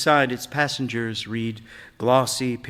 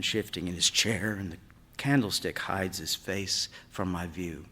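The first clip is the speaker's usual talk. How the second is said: ordinary delivery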